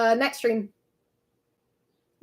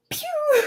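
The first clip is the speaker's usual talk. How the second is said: very high-pitched pew sound effect; high imitated "pew" noise. Streamer's hot guy impression, high sound effect. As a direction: squeak out a high zap noise. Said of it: "I can do a hot guy impression".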